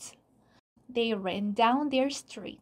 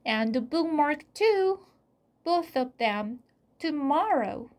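higher, mildly raised voice; said loudly; 5 semitones higher, 4 dB louder than usual. A narrator's reading. Jack adds a promise for a hidden listener, said loudly. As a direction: announce it clearly to someone out of sight. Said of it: "Jack said loudly".

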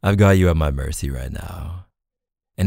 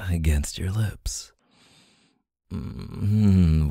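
usual speaking voice

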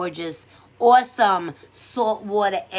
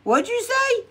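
high puzzled what'd you say; mock baffled ask; baffled huh; 11 semitones higher than usual. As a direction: act confused by a friend's remark. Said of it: "I'm like".

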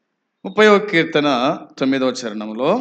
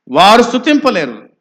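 raised, loud, forceful declaration; emphatic preaching; 7 semitones higher, 7 dB louder than usual. Real, not acted. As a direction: drive the point home to the congregation. Repeats the point forcefully to congregation.

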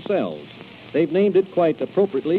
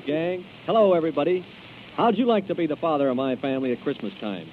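ordinary talk, nothing marked

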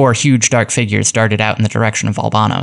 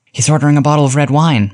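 higher-pitched narrated excitement, not hushed; excited murmur; 5 semitones higher than usual. A narrator's reading. Luigi reporting from the dictograph in the back-room sting, excited. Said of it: "murmured Luigi, dancing up and down with excitement".

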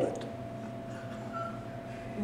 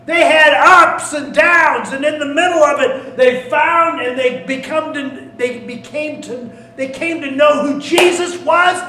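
loud, pitched-up preacher's proclamation; full-force preaching; 7 semitones higher, 10 dB louder than usual. Acted. Preacher proclaiming to the congregation, loud and rising. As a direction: preach it out to the whole room.

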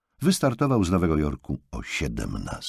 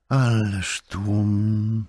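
slow, drawn-out dismay at a crowd; slowly sighed-out worry; worried dismay; 3 semitones higher, much slower than usual. A narrator's reading. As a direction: stretch the words out, worried. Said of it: "zmartwiła się Madeleine".

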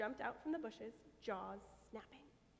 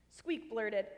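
plain reading voice

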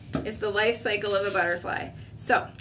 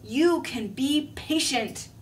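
self pep talk; 3 semitones higher than usual. Acted. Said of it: "He says".

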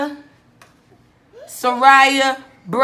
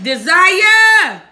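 calling out a name; 3 semitones higher, 6 dB louder than usual. Real, not acted. Calling for missing kids.

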